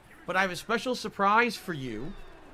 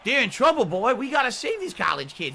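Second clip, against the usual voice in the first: hammed-up Southern colonel voice; terrible southern accent; 3 dB louder than usual. Streamer as fried-chicken colonel in a horror game, terrible Southern accent. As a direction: play a bad Southern colonel rallying everyone. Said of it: "this terrible southern accent".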